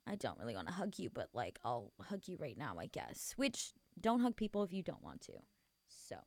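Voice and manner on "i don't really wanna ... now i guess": low, quiet, grudging mumble; reluctant hugger. Acted. Someone who doesn't really wanna hug you, reluctant. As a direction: give in half-heartedly, trailing off much softer. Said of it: "you can tell when somebody just, like, is like, "Ugh".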